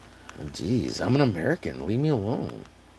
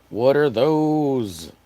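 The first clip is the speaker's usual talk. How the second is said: very slow "What are those?" at normal pitch; drawn-out meme line; 2 semitones higher, 4 dB louder, much slower than usual. Retold or imitated. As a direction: stretch "What are those?" slowly, no yelling.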